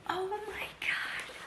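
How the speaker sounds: real breathless whisper, the second phrase slow; hushed, overwhelmed by the gift; awed whisper. Real, not acted. Opening a friend's gift on camera, awed whisper.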